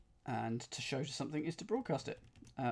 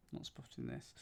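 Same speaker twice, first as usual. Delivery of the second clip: breathy whisper under the breath; whispered to self; 13 semitones lower, 14 dB quieter than usual. Real, not acted. Stuck solver whispering frustration to himself, hushed. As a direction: murmur it to yourself, stuck. Muttering while stuck on clue.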